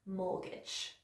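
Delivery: MORTGAGE said softly, low and clearly articulated; careful model pronunciation. Shown as a demonstration. Teacher models a word for learners, careful pronunciation. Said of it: "focusing on that phonetic spelling".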